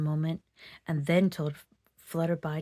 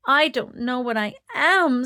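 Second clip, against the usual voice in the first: sobbing; 5 semitones higher, 7 dB louder than usual. Acted. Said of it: "sobbed Flutterby".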